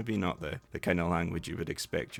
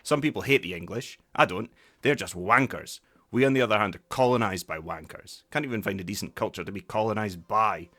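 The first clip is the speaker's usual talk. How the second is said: loud, disgusted tirade; Ewan McGregor's character; 3 semitones higher, 6 dB louder than usual. Acted. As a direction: spit out a bitter rant at your friend. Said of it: "Ewan McGregor's character turns around and goes".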